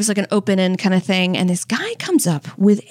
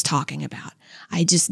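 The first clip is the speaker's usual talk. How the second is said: whispering; 6 semitones lower, 6 dB quieter than usual. Real, not acted. Sweating, holding in diarrhea.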